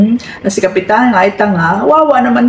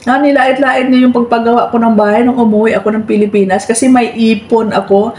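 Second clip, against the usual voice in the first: ordinary talk, nothing marked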